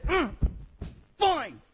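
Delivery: a grudging, pouty "fine"; huffy kid giving in. Pretend. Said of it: "stomp or throw a fit".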